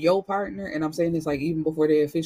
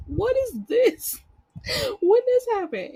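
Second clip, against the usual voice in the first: much higher squeaky mock outrage; outraged grandson. Grandson catching grandma kissing her boyfriend, mock outrage. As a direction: play him scandalized at grandma's kiss. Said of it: "he was like".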